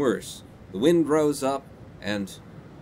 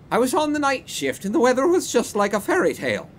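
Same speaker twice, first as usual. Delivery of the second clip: put-on high, loud drunken Irish accent; [name]'s drunken Irish; 10 semitones higher, 5 dB louder than usual. Drunken old porter telling police about the storm, Irish accent. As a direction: ham up a tipsy Irish brogue. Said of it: "need that accent".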